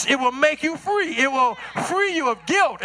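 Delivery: ordinary delivery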